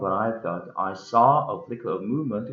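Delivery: lively storybook reading with voiced dialogue; story narration. A narrator's reading. Harold tells of naming the rabbit, animated story narration. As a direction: read it like an animated audiobook.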